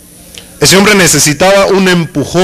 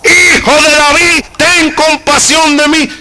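higher, slower pleading cry; 9 semitones higher than usual. Retold or imitated. Preacher voicing Bartimeo still calling out, high slow plea. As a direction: refuse to be silenced, call out again. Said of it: "A mí nadie me va a encerrar".

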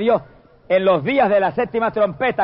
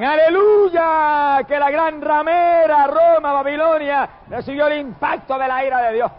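heavenly shout; 6 semitones higher than usual. Acted. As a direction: shout it as heaven rejoicing over Rome. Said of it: "dice que en el cielo gritan".

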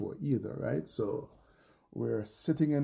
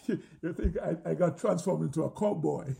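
talking through laughter, pitched higher; laughing; 6 semitones higher, faster than usual. Real, not acted. Athlete amused by his own cowboy whoop, laughing. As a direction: chuckle through the words.

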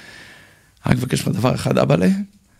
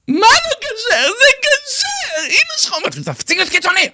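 mother yelling; slower than usual. Retold or imitated. Mother scolding her son on the phone, very high tearful shout. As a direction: play his mother shrieking in tearful protest.

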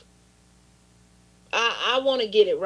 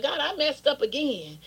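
usual speaking voice

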